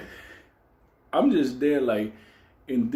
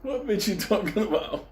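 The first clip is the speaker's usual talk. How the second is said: incredulous; 10 semitones higher than usual. Retold or imitated. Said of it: "I'm like".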